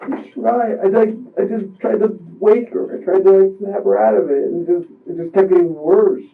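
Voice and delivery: high, strained voice near tears; pushing the words out through distress; tearful. Real, not acted. Describing to the investigator trying to wake her, tearful. Still upset, recounting.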